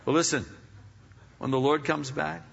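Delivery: ordinary talk, nothing marked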